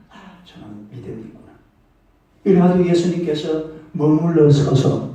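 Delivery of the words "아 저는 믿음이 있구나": much softer, lower, quick; soft aside. Retold or imitated. Jesus quietly seeing Bartimaeus's faith, soft quick murmur. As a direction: murmur it to yourself.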